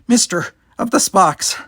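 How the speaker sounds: the youngster calling to the Vulcan, out of breath; puffing. Acted. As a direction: gasp it out, winded from the fight. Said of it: "he puffed".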